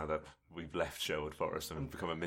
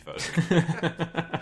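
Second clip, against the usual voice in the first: mock-slow and higher, laughing; drawn out; 10 semitones higher, 6 dB louder than usual. Real, not acted. The friend mocks Raven's grudge, very slow and laughing. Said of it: "drawn out mockingly".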